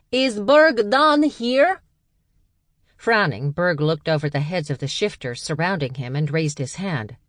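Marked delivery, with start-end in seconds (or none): 0.1-1.8 much higher and louder, slow; calling out. A narrator's reading. A stranger seeking Berg, high loud call. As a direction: call his name over the heads. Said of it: "a female voice called out".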